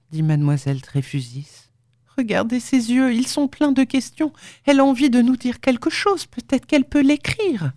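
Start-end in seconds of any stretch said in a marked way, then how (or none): 2.2-7.7 put-on high, eager young woman's voice; pleading young woman. A narrator's reading. Leonora urging the surgeon to look, pleading. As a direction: urge him on, pitched well up.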